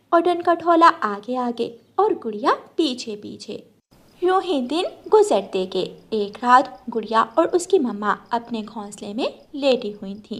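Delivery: usual speaking voice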